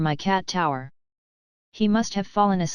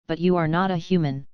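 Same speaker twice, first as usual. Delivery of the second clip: normal talking voice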